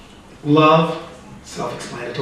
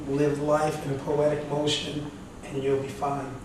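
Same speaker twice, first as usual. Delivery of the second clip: quiet, measured delivery with long pauses; softly measured advice; 7 dB quieter than usual. Retold or imitated. Speaker retells advice given to a questioner, softly measured. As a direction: deliver it softly, letting each sentence settle.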